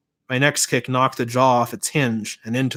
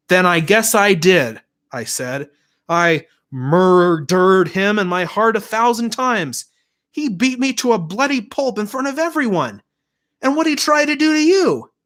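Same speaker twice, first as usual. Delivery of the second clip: bitter defiance; 8 semitones higher, 4 dB louder than usual. A narrator's reading. Quint confessing his hatred to Vanessa, bitter loud defiance.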